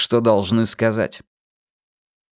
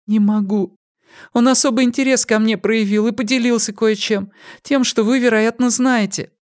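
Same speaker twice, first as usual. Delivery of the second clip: put-on higher, hesitant young woman; 12 semitones higher than usual. A narrator's reading. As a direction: confess timidly as a young woman. Pip voiced by narrator.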